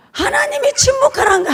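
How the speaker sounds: soaring 하나님이, then a falling line; emphatic high plea. Acted. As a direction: lift 하나님이 high, near tears. Preacher stresses God's command.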